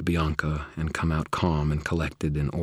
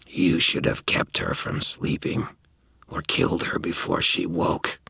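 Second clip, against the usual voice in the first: slow, rasping, malevolent otherworldly voice; something inhuman speaking through a girl; harsh alien voice. Acted. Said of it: "her voice came out a low, harsh sound totally unlike her natural tones, alien and malevolent".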